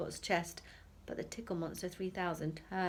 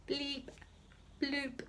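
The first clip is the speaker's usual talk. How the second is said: robot bleeps; 7 semitones higher, 4 dB quieter than usual. A narrator's reading. Robot noises imitated.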